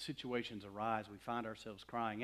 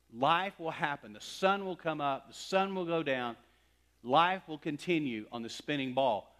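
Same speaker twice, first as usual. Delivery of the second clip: loud, raised preacher's proclamation; emphatic preaching; 5 semitones higher, 7 dB louder than usual. Real, not acted. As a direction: proclaim it firmly to the congregation. Preacher builds to a climax.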